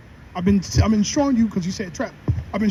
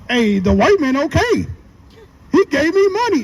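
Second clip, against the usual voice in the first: higher-pitched, pleased quoting of a won-over man; mock impressed; 6 semitones higher, 4 dB louder than usual. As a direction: act out being won over by a handout. Voicing people pleased with stimulus.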